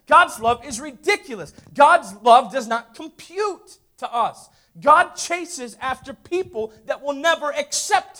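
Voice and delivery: loud, high preaching climax; full force preaching. Acted. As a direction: preach it out to the church.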